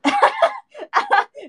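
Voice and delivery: high, loud real laugh; burst of laughter. Real, not acted. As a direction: crack up at the mic. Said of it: "No, stop laughing".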